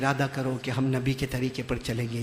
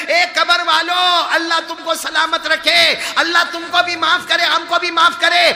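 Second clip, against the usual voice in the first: preaching at full force; 13 semitones higher, 13 dB louder than usual. Acted. Preacher rendering the prayer for the crowd, full force. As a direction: proclaim it to the whole gathering.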